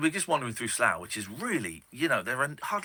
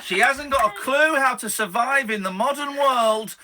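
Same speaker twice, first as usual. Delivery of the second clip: hyped announcer voice; 8 semitones higher, 7 dB louder than usual. Acted. Host hypes caller like announcer.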